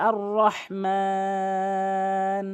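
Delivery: slow, মান held for three alifs; stretched on pause. Shown as a demonstration. Teacher shows the long stretch when pausing at রহমান. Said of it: "যদি ওয়াকফ করেন".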